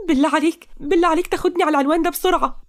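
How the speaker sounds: high-pitched, desperate plea; tearful begging. Acted. Fleeing girl begs a driver for a lift, tearful. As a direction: beg urgently in an acted crying voice. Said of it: "تقى بعياط".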